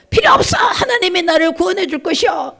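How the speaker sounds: high, shouted refusal; defiant shout from the water; stubborn refusal. Acted. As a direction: shout it up high, too proud to grab the ladder. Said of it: "이러고 안 잡는 거예요".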